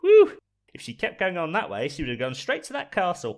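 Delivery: high Cockney creature aside, relieved; high, relieved little Cockney voice; little Cockney worm. Acted. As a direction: confide it as a tiny Cockney worm. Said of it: "The little cockney worm".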